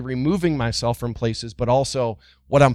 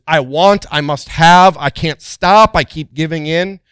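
louder, pleading lines of someone hooked on wanting; voicing craving; 2 semitones higher, 7 dB louder than usual. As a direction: push it out like a desperate craving.

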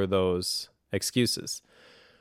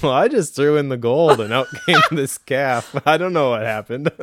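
mock-innocent excuse, pitched up and loud; feigned innocence; 4 semitones higher, 6 dB louder than usual. Podcaster mimicking Aaron's golden calf excuse, mock innocent. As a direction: plead cluelessness with exaggerated, amused surprise. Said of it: "What Aaron says".

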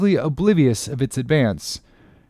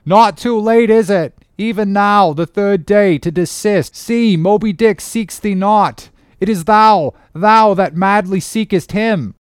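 high, loud, urgent plea; crying out; 8 semitones higher, 6 dB louder than usual. A narrator's reading. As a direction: plead loudly across the water to the captain. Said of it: "cried Starbuck".

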